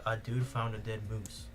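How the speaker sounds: ordinary delivery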